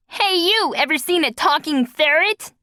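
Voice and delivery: high cheeky creature voice; taunting ferret. A narrator's reading. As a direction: tease the dogs as a small ferret.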